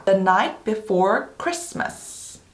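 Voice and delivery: very slow, word by word; unhurried teaching read with gaps between words; slow demonstration. Shown as a demonstration. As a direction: model the title slowly for repeating. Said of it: "K는 발음이 되지 않는다".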